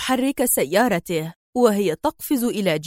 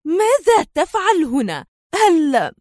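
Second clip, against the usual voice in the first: higher-pitched insistent angry demand; 6 semitones higher, slower than usual. A narrator's reading. Villainess stamps and demands an answer, angry. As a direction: repeat the question angrily, pitched up. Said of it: "وهي تكرر سؤالها في غضب".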